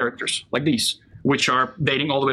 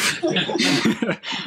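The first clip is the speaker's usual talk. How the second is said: breathy laugh; 7 semitones higher than usual. Real, not acted. Laugh after the word.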